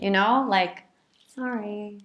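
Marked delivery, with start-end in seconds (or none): 1.3-2.0 small, soft singsong sorry; playful. Shown as a demonstration. Partner making up over little things, playful. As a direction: coax forgiveness with a little sorry. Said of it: "it's a playful repair attempt".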